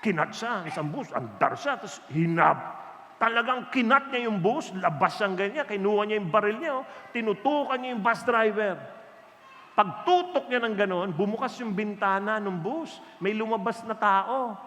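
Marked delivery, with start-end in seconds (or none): none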